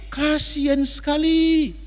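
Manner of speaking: a slow, pitying sigh of a line. Acted. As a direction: let the pity sink in slowly. Pity after loud preaching.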